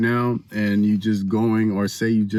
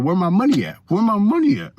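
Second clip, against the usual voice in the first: high, insistent demand for money; mildly hammed-up pestering creditor; 10 semitones higher, 4 dB louder than usual.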